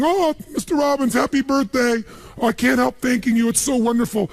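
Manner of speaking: high, gushing grateful maid voice. Retold or imitated. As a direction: coo it like a thrilled housekeeper. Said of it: "she's back at home in the castle going".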